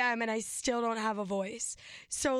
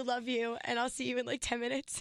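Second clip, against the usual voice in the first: ordinary talk, nothing marked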